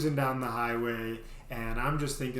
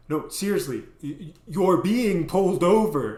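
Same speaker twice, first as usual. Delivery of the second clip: louder, higher, stammering urgency; insistent warning; 5 semitones higher, 8 dB louder than usual. Retold or imitated. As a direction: insist it hard. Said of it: "she goes".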